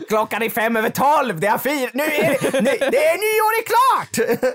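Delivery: half-laughing retold shout at the neighbours; hammed-up yell of a man annoyed at late fireworks; mock yell across the lake. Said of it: "skrek jag".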